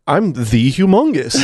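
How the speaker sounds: "the" said as a long, weighty "thee"; mock-proud emphasis on "the", pronounced "thee"; stress on THE. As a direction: announce the title slowly, leaning on "the". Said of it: "it's credited as the Humongous".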